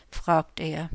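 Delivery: ordinary delivery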